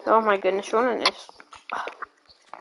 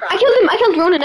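high-pitched gleeful boast; excited triumph; 4 semitones higher, much faster than usual. Real, not acted. Player announcing a kill to friends, excited triumph. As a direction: crow about your win, pitch starting high.

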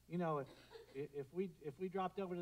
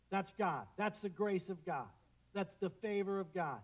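usual speaking voice

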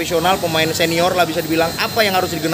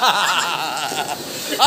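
quiet chuckle; soft laugh; 4 dB quieter than usual. Real, not acted. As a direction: laugh softly. Laughs while teasing guest.

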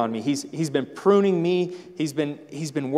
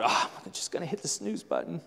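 sleepy mumble; 11 dB quieter, faster than usual. Retold or imitated. Sleepy churchgoer hitting snooze.

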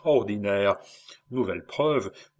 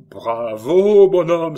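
loud laughing bravo, much louder; hearty shout of approval, higher; exclaiming; 7 semitones higher, 12 dB louder, slower than usual. A narrator's reading. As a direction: burst out in delighted praise. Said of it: "s'écria-t-il".

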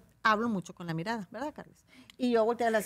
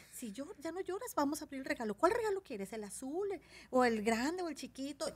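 put-on soft, higher coaxing to a child; soft sing-song coaxing; 6 semitones higher, 6 dB quieter than usual. Shown as a demonstration. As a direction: tempt the kid with presents. Convenciendo a un niño. (voz dulce y suave ofreciendo regalos)

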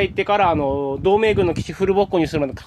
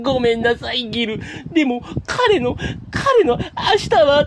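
higher, tearful, voice breaking; crying voice; 5 semitones higher, slower than usual. Shown as a demonstration. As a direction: sob the farewell lines out. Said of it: "鼻の息を止めたのを抜きながら言うと". (泣きながら高い声で)

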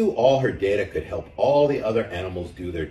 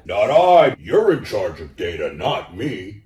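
cow refusing; 7 dB louder than usual. A narrator's reading.

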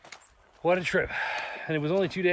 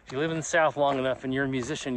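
ordinary delivery

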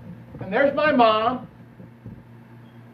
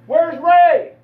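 sudden, higher and louder alarm; startled realization; 7 semitones higher, 8 dB louder than usual. Retold or imitated. Mother at home suddenly notices her son is gone, alarmed. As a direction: catch yourself missing someone.

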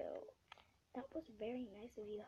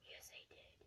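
whisper; 16 dB quieter than usual. Real, not acted.

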